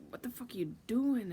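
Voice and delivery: retold exasperated outburst; mock outburst. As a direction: throw it at him, exasperated. Mimics others' reaction to him.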